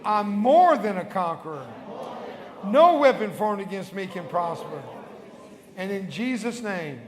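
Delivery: building to loud bold proclamation; exultant preacher declaring victory over the devil; triumphant declaration. Real, not acted. Prayer climaxes in triumphant declaration.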